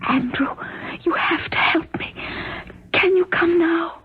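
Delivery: high, tearful whispered plea; desperate, strained half-whisper; urgent whisper. Acted. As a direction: whisper for help, struggling to get words out. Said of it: "Why are you whispering?".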